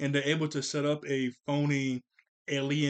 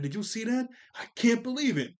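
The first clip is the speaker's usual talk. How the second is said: excited Dill; 8 semitones higher than usual. Retold or imitated. Dill calling his brother about the sign, amazed. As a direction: gasp it out like you've seen aliens.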